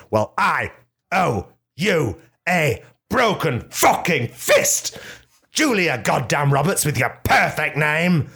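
high, slow, hammed-up menace; mock threat. Hammed-up slow threat to Julia Roberts over her perfect name. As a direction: spell out each word like a threat. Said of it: "I said".

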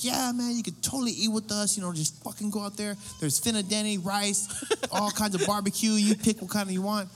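high, upbeat welcoming voice; friendly host. Retold or imitated. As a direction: cheerfully welcome the guests in. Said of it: "And the Noel's like".

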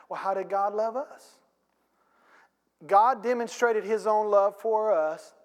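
normal talking voice

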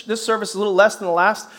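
usual speaking voice

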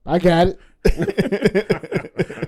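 stretched way out, laughing through it; slow laughing drawl. Shown as a demonstration. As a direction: drag every word out while laughing. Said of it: "drawn-out repeat of catchphrase".

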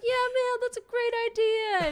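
mock cheerful yes-man with kids; lightly voiced eager grown-up; imitating him. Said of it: "always like".

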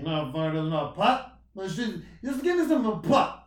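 put-on slurred, slow Bill Cosby-like drunk voice. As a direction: ham up a sloppy drunk at the bar. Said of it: "my drunk voice sounds like Bill Cosby".

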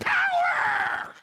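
shrieking high strangled Power; Kramer impression. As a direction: yelp like electrocuted. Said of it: "Like Kramer from Seinfeld".

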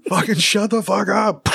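high, slow mock Boston yell at the president; a hammed-up heckler shouting him down.

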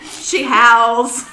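loud laughing impression of a frantic new dog owner; panicked friend. Friend mimicking the other's panicked call about her howling dog, mock panic. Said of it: "You were, you were like".

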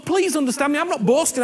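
normal talking voice

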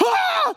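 very high mock scream of fright; hammed-up yelp of shock; startled scream. Shepherds startled by floating angel.

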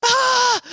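very high-pitched "ah" of delight; hammed-up squeal; excited cry. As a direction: imitate an overexcited kid's squeal. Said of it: "He's so excited".

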